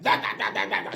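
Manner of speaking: soft, rapid-fire mock jabber; mocking blah-blah drone; nonstop chattering neighbor. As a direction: yammer on in mimicry of the neighbor. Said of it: "She wouldn't stop talking".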